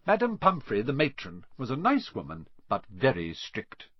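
ordinary delivery, nothing marked

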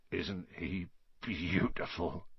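slow, soft, hushed murmur of a big man in awe; tender murmur. A narrator's reading. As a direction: murmur it softly, slowly, besotted with the baby dragon. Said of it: "Hagrid murmured".

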